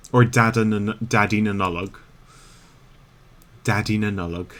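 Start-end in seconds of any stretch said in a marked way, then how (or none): 1.1-4.5 "Daddy Nanulog" with a hard T onset, Irish stress on "Daddy"; Irish Santa name. Shown as a demonstration. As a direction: say "Daddy Nanulog" as Irish speakers do, "tæd-ee NUN-uh-log". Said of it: "That is actually full-on Irish speak there".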